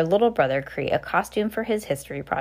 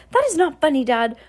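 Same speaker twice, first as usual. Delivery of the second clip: annoyed; 8 semitones higher, 3 dB louder than usual. A narrator's reading. Heidi stomped her foot.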